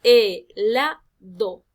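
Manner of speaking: "helado" very slow and loud, e-LA-do in pieces; stressed middle syllable. Shown as a demonstration. Teacher pronouncing a word to find its stress, middle syllable stressed. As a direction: stretch "helado" syllable by syllable, leaning on la. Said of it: "Si yo pronuncio esta palabra".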